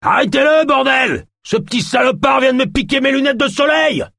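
high, loud yell of a robbed man; furious shout. Acted. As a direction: scream for someone to catch the thief. L'homme volé crie au voleur. (cri furieux, aigu et fort)

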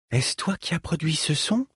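put-on wondering woman addressing a child; astonished. A narrator's reading. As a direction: ask in hushed amazement. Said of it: "s'étonna Hiroko".

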